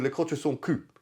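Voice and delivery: French pronunciation; French accent. Shown as a demonstration. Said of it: "my French accent's important".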